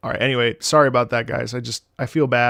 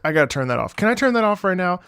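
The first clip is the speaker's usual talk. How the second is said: normal talking voice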